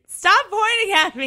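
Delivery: high, loud shout through laughter; laughing protest. Real, not acted. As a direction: yell it in laughing protest at being singled out. Teased, protests over laughter.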